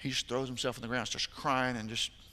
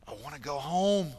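imitating a sobbing boy; 2 semitones higher than usual. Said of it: "with how hard he's crying".

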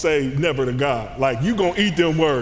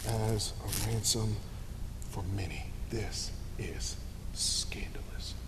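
whisper; 9 semitones lower, 14 dB quieter than usual. Real, not acted. Hushed aside about the scandal.

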